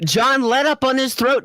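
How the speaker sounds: ordinary talk, nothing marked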